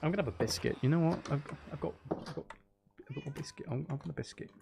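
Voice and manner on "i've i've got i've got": ordinary talk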